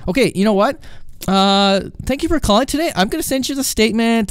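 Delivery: high-pitched customer service sign-off; fake-polite brush-off. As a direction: cheerily cut the caller off. Agent hurriedly ending the call.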